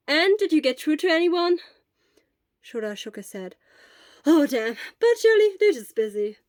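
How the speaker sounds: a worried friend checking in; Hisashi. A narrator's reading.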